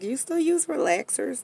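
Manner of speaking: high, surprised ask; surprised question. Retold or imitated. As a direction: ask it in disbelief. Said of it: "and I'm like".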